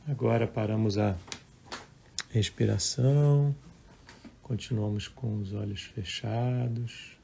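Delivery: calm, measured meditation voice with long pauses; guided meditation. Acted. Leads a live guided meditation. (voz calma de meditação guiada)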